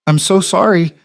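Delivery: high, small panicked apology; a frightened waitress apologizing, small and high; small scared voice. Acted. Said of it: "Her voice came out smaller".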